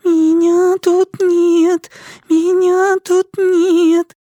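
put-on high, slow sing-song little girl; thin drawn-out child voice. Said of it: "тоненько тянула Пелерина".

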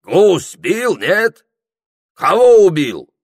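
high, loud Circassian voice; Circassian character. A narrator's reading. Circassian quizzing Прохор about hunting, loud Circassian voice. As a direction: pester loudly in Circassian manner. Circassian questions the waking sleeper.

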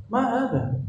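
high, puzzled question. Retold or imitated. Yemeni host puzzled by a soft salam, high and baffled. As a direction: sound baffled by the odd greeting. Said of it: "Orang sana malah heran".

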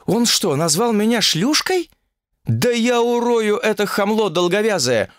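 high, angry shriek of outrage; outraged young woman yelling; furious outburst. Acted. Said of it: "побагровела от злости".

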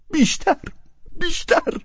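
a stammering cry of بیشتر. A narrator's reading. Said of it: "با لکنت زبان ادامه داد".

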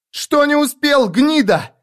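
very high, angry challenge; furious girl. A narrator's reading. Gang girl demanding answers from the man she blames, furious. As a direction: spit out the question in a high, raging voice.

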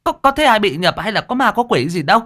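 put-on high, sharp scolding old landlady; scolding shout. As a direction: snap at them to stop the nonsense. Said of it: "cô Thuận quát lên".

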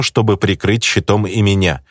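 plain reading voice

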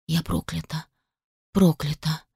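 barely audible, breathy repetition; stunned whisper. A narrator's reading. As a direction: whisper it to yourself in shock. Shocked self-whisper. (потрясённый шёпот)

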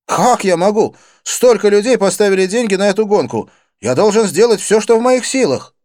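loud, high-pitched protest; agitated friend. A narrator's reading. Friend refusing to drop the bet, agitated. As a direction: protest urgently, voice pushed up high.

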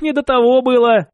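teasing echo. Acted. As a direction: throw his words back with a grin. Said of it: "Василий рассмеялся".

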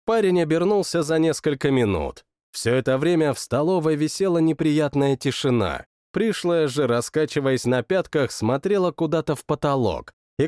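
plain reading voice

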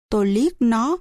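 plain reading voice

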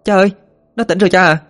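excited shout. Acted. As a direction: cry out that he's awake. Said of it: "thì hét lên".

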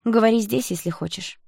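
mildly defiant reply; frowning defiance. A narrator's reading. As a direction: push back with a frown. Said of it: "нахмурилась Стеша".

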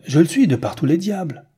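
loud, high-pitched insistence; indignant cry. A narrator's reading. Newman insisting to Bellegarde that he is noble, crying out. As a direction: cry it out hotly, pitch and volume up. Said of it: "cria Newman".

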